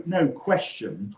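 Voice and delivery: usual speaking voice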